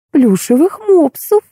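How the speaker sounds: high, drawn-out puzzled question; surprised. A narrator's reading. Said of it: "удивилась Роза Леопольдовна". (удивлённо, протяжно)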